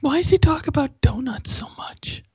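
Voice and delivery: high whispered inner voice; whispered Gaffigan aside. Pretend. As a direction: whisper it like a judging onlooker. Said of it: "doing that voice again".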